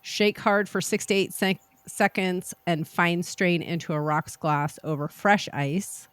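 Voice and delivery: ordinary talk, nothing marked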